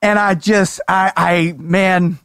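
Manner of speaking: ordinary talk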